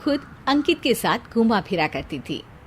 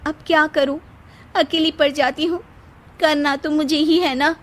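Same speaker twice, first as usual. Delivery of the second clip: higher, self-pitying sigh of a voice; put-upon wife; 6 semitones higher than usual. Acted. [name] plays the victim before Ankit, lightly higher. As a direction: act overworked for the husband.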